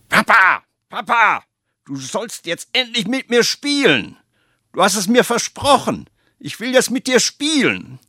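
put-on high, pleading child's voice; whining little boy. Little Franz begs his busy father, high and whiny. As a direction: beg like a small boy wanting to play.

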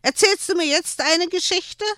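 put-on high pleading little boy voice; child begging for a story; eager child. A narrator's reading. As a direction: ask hopefully like a small boy.

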